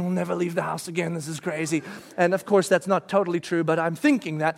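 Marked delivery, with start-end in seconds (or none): 0.0-1.8 softer, lower resigned thought; quiet inner thought. Retold or imitated. Dad thinking while driving: soft, resigned. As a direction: mutter it to yourself. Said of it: "driving home thinking".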